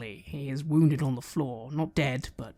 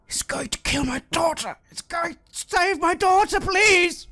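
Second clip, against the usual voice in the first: high, rising desperate plea; pleading; 14 semitones higher, 4 dB louder than usual. Acted. Wounded father pleads for his daughter, desperate. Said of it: "Ebda just sort of mutters".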